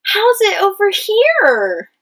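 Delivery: high slow puzzled question. Shown as a demonstration. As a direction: ask it in a high, curious voice. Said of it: "It's a question".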